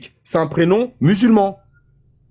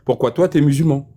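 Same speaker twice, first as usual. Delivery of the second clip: ordinary delivery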